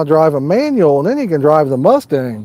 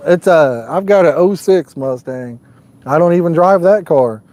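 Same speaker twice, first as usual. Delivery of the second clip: ordinary talk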